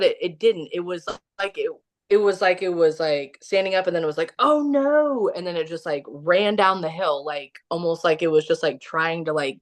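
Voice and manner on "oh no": high-pitched retold oh-no; lightly acted alarm, pitched up; mock alarm. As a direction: imitate a startled creature's dismay. Acting out the creature's alarm.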